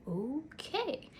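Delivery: drawn-out, high rising "okay"; taken-aback okay. Retold or imitated. Babysitter answering a kid in meltdown, taken aback. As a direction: humor him with a wary, lilting okay.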